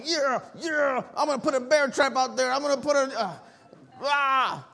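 high-pitched, sputtering mock fury; mock rage. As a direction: act so mad the words give out into a growl. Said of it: "I'm so mad and I'm so angry".

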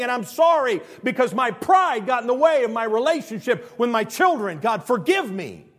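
loud, high cry to God; impassioned confession. Acted. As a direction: build to a full-force plea for forgiveness. Acts out specific confession prayer.

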